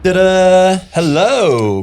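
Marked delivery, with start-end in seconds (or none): 0.0-0.8 ta-da reveal. Acted. Comic reveal of t-shirt.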